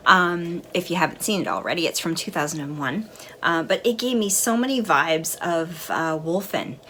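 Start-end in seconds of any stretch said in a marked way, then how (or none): none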